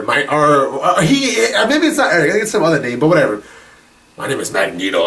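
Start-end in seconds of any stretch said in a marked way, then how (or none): 4.2-5.1 low, dramatic villain reveal; menacing reveal. Retold or imitated. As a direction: drop your voice to announce your true name. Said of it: "And he's like".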